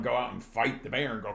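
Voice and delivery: usual speaking voice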